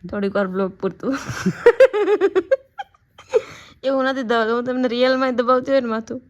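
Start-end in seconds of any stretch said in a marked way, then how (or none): 1.2-2.6 laugh. Real, not acted. Joking about massaging for vlog.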